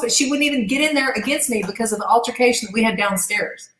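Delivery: ordinary talk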